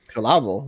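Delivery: "syllable" echoed as sil-AH-ble; mock-French pronunciation. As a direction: stress the middle of "syllable". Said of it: "Ooh, French".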